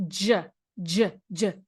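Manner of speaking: teacher modelling the J sound; J sound demo. Shown as a demonstration. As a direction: sound out J, J, J clearly as in gel. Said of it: "the tongue comes up to the top of the mouth".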